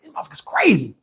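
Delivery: low muttered disbelief; 1 muttered aside. Retold or imitated. Telling the car about twerking kids, low muttered aside. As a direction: mutter it low under your breath.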